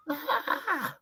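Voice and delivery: choked high squeak with a laugh; a squeak instead of a scream; a strangled squeak; tiny squeak. Shown as a demonstration.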